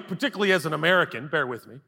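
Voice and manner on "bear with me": normal talking voice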